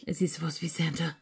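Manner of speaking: soft unvoiced whisper. Real, not acted. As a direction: whisper it as a private wonder. Muttered question about figure.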